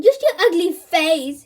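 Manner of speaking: loud, slow jeering hoot; mock him with a hoot. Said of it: "hooted Darren".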